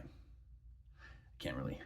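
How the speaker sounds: trailing off into a breathy whisper; near whisper. Retold or imitated. Father mulling to his son, a soft trailing whisper. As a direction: let it fade to almost nothing. Dad voiced in retold parable.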